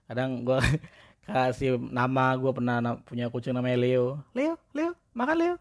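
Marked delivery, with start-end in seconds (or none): 4.4-5.6 very high singsong call to a cat. Retold or imitated. Owner calling his cat Leo to eat, very high singsong. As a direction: coo the cat's name up high.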